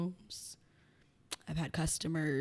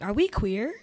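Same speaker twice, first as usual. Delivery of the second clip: retold puzzled question, louder, higher, rising; mock bewilderment, pitched up; 4 semitones higher, 5 dB louder than usual. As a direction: ask it as if baffled by the word. Retelling puzzled reaction to term.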